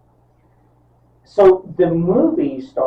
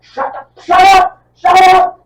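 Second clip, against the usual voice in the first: high, loud imitated outburst; enraged shouting; 12 dB louder than usual. Cult leader to the laughing wife, enraged shout. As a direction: shout it, building to a frantic mock scream.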